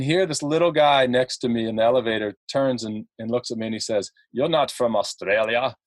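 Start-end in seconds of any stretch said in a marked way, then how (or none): 4.3-5.7 lightly imitated Austrian-accented heckler; a hint of an Arnold-style accent; mock action-star accent, lightly done; Arnold Schwarzenegger. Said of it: "Arnold Schwarzenegger".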